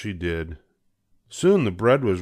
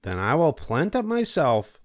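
higher hen voice, determined; Little Red Hen; 7 semitones higher than usual. A narrator's reading. Hen deciding to plant alone, higher determined voice. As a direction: resolve to do it alone. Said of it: "said the Little Red Hen".

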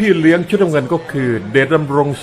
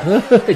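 high burst of laughter; 8 semitones higher than usual. Real, not acted. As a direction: let a high laugh escape. Amused by low kick.

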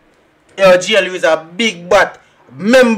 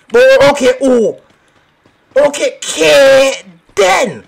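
louder, punchier repeat; 7 semitones higher, 5 dB louder, slower than usual. Real, not acted. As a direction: hit it louder the second time.

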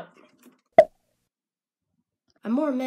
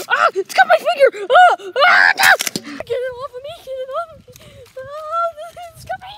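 panicked high screams, laughing; screaming at a spider; 20 dB louder than usual. Acted. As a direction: shriek as it bites your hand. Creature bites his fingers.

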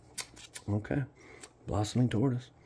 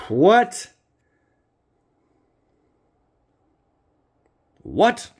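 high, loud disbelief; shocked what; 10 dB louder than usual. Real, not acted. Reacting to a pulled card, shocked and loud. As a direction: yelp it out in disbelief.

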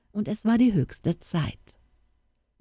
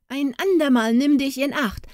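calling out; 9 semitones higher than usual. A narrator's reading. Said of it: "rief er".

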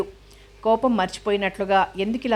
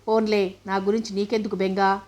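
ordinary delivery

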